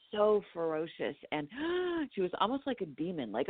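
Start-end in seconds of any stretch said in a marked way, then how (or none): 1.5-2.1 sharp frightened gasp; high startled gasp. Retold or imitated. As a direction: gasp as if scared. Telling scary dream.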